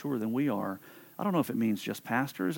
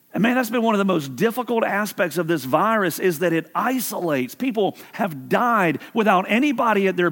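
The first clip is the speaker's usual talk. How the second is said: higher, louder preaching push, urgent and pleading; full-force preaching; 7 semitones higher, 6 dB louder than usual. Acted. Pastor urging the online and present congregation, impassioned preaching. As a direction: drive it home to the congregation like a sermon peak.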